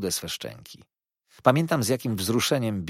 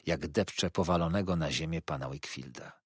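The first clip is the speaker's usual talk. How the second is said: ordinary delivery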